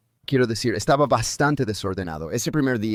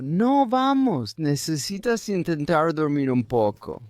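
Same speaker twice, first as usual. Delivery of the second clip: coaxing wife; 5 semitones higher than usual. Retold or imitated. Wife coaxing him to bed.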